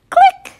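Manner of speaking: high bright camera click. Acted. Reader makes the camera's click sound. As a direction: chirp the click like a tiny shutter.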